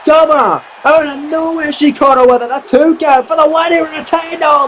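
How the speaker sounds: high, urgent yell on the comeback; excited play-by-play. Real, not acted. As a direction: ride the surprise comeback at full pitch. Sudden comeback slam call.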